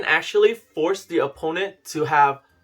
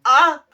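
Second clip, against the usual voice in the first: sudden yelp; 12 semitones higher, 9 dB louder than usual. Acted. Yelps mid accent bit.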